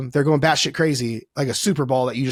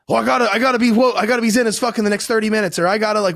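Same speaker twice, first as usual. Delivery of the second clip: higher, rushed mock panic about getting zen; mock frantic meditator; 7 semitones higher, faster than usual. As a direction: play the stressed-out beginner, a bit hammed-up. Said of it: "they're like".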